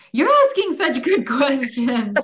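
flattered giggle while praising the questions; laughing. Real, not acted.

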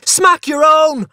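put-on very high, loud, drawn-out child's yell; furious scream. A narrator's reading. Boy defying a threatened smacking, furious scream. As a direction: scream it back as an enraged small boy. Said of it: "I screamed furiously".

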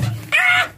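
a real shriek of fright at something on her back; startled scream. Real, not acted. Startled by something on the rabbit, real shriek. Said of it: "Ich schrei rum wie so eine Bläde".

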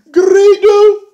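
very high, loud squealed name; high delighted shriek. Pretend. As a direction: shriek the name like a crowd pop. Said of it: "announcing the reveal".

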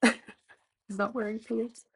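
giggling whisper; whispered aside with a laugh; amused whisper. Real, not acted.

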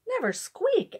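high-pitched, questioning echo; incredulous echo. A narrator's reading. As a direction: echo it in high-voiced disbelief. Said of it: "echoed the little man".

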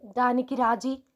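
ordinary delivery, nothing marked

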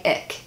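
ich with a hard k ending; mispronounced as ick. Shown as a demonstration. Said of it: "it sounds like I'm saying ich instead of".

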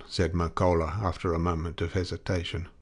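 ordinary delivery

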